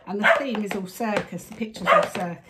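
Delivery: ordinary talk, nothing marked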